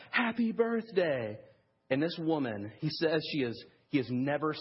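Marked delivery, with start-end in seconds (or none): 0.1-1.4 high, drawn-out birthday cheer; shout. Retold or imitated. Tony leads the diner in surprising Agnes, celebratory shout. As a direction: imitate leading a group shout. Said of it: "Tony leads them to shout".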